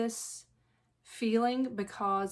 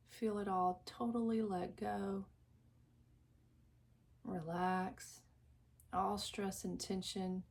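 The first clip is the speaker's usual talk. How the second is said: guided meditation; 6 dB quieter than usual. Acted. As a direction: lull the listener into calm, drawing out each phrase. Leads a guided visualization.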